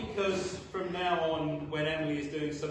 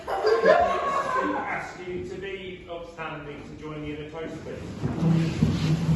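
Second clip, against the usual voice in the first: wedding toast speech; 10 dB louder than usual. Acted. Formal toast to guests.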